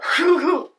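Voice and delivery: loud burst of real laughter; laughing fit. Real, not acted. Laughing while telling a friend the story. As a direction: cracks up mid-story.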